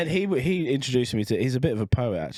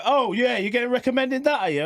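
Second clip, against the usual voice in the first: teasing Leanne; 13 semitones higher than usual. Retold or imitated. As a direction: needle him like a suspicious wife. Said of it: "Leanne looks at me and go".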